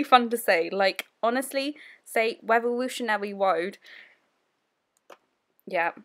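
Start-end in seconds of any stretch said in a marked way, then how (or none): 2.4-3.8 "Revolutionary Road" with every R turned into a W; "Revolutionary Road" said as "Wevolutionawy Woad"; Jonathan Ross lisp. Retold or imitated. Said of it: "I sound really Australian when I say that".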